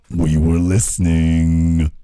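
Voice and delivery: a mock villain's deep, slow warning; spooky low announcer voice saying we were listening; ominous deep voice. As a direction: drop the voice low and slow, menacing. Ominous reply to Angela.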